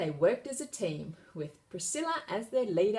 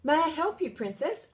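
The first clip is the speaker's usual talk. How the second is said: eager cook; 10 semitones higher, 5 dB louder than usual. A narrator's reading. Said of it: "asked the cook".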